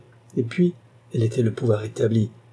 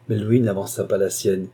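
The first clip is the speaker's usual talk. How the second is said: ordinary delivery, nothing marked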